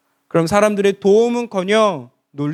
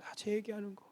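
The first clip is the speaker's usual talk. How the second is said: muttered aside; 20 dB quieter than usual. Real, not acted. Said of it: "다 제 얘기하는 겁니다".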